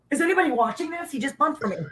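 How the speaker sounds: quick, high call to the room; excited call-out. Retold or imitated. Wrestler calling the gym to witness Flair's bump, high. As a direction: shout to everyone around in disbelief. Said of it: "I go".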